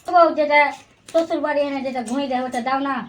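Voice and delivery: very soft, low wheedle; low, hushed plea to a wife; coaxing husband. Acted. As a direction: sneak in a delicate request under the breath.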